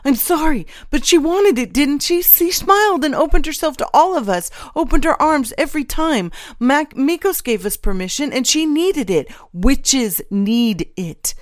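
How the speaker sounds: high, loud, desperate excuses; distraught, high-pitched begging; frantic pleading. A narrator's reading. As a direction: wail your justification to your accusers. Stahl frantically excusing himself, breaking down.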